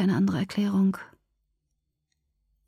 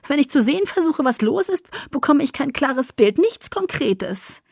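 high, louder character voice, pressing on; Alice's frustrated voice; 6 semitones higher, 4 dB louder than usual. A narrator's reading. Alice describing her unclear visions to Edward, high and agitated.

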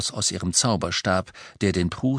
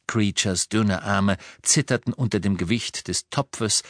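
usual speaking voice

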